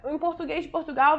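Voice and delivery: ordinary talk, nothing marked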